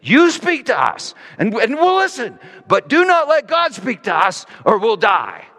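pleading people. Retold or imitated. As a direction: beg him as if God's voice could kill you. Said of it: "the people literally plead with Moses".

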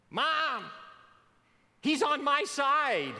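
put-on high, whiny kid tattling to parents; whiny tattling little sibling. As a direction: whine like a kid in the back seat. Kids bickering in back seat.

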